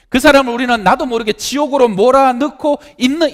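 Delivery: high, loud, impassioned sermon voice; fervent preaching. Acted. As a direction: preach it hard to the congregation. Preaching at full force.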